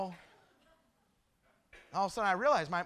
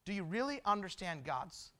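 quiet, lower, with pauses; soft preaching appeal; 4 semitones lower, 6 dB quieter than usual. Real, not acted. Preacher asks congregation about God's love, softly appealing. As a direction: drop to a gentle, searching question. Hushed appeal after climax.

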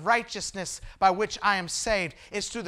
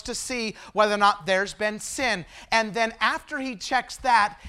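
preaching; 4 dB louder than usual. Acted. Preacher reads Revelation, then expounds.

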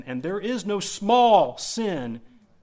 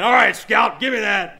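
loud, high order to a misbehaving dog; raised-voice telling-off; commanding the dog; 4 semitones higher, 10 dB louder than usual. Retold or imitated. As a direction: take charge and call out the command.

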